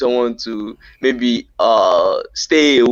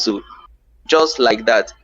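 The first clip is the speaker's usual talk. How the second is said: ordinary talk, nothing marked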